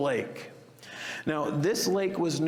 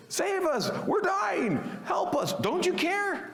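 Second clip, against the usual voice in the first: panicked chaotic cries; 13 semitones higher than usual. Retold or imitated. Disciples in a sinking boat shouting to Jesus, panicked and frantic. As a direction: shout it like men about to sink. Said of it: "all just said in the chaos, quickly".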